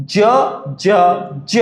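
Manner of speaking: J said as "juh"; letter sound. Shown as a demonstration. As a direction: say J as "juh", not "jay". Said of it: "J sounds like J".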